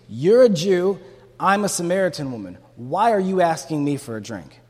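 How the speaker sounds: loud, indignant challenge from the Samaritan woman; sharp response. Acted. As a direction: punch it out sharply as a rebuke. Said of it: "Her response was sharp".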